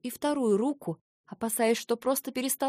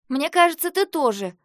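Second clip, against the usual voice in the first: loud higher parry; retort; 6 semitones higher, 7 dB louder than usual. A narrator's reading. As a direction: fire it right back. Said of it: "парировала она". (колко парируя)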